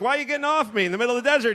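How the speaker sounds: quick, high, puzzled; a bewildered horse asking why; confused horse. Pretend. Abandoned horse confusedly asking its rider why.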